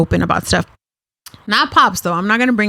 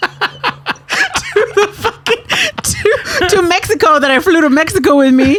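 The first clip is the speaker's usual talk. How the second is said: laughing disbelief; 9 semitones higher, slower than usual. Real, not acted. Laughing retelling the wedding.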